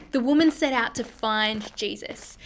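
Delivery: ordinary talk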